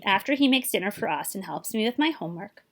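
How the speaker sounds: usual speaking voice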